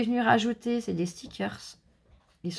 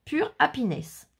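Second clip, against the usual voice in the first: higher, slow French-accented English; 5 semitones higher, slower than usual. Real, not acted. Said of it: "du mal avec mon accent anglais".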